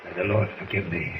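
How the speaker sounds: low, hushed prayer for forgiveness; solemn prayer. Acted. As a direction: murmur it, heavy with guilt. Commander after sending man away.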